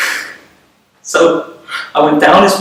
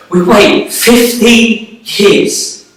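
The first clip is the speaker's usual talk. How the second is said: slow, higher, louder, each word weighed; emphatic deal-making; 4 semitones higher, 6 dB louder, much slower than usual. Acted. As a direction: lay down the terms slowly and firmly. Sets terms of the deal.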